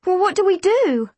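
high-pitched, flustered query; urgent, at a loss; anxious question. A narrator's reading. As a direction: ask in mounting panic. Anxious question facing the monster.

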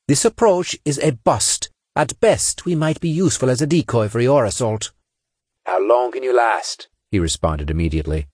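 officer under pressure, firm; tense commander voice. A narrator's reading.

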